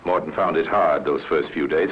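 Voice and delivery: normal talking voice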